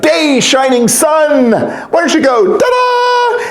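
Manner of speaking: high, slow, rising to a big ta-da; bright fanfare call; joyful call. A narrator's reading. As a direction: call out the sun like a showman. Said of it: "He shouted".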